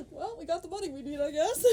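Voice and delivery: high, giggling character voice; high laughing imitation of the characters; laughing mock panic. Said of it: "And then it's just like".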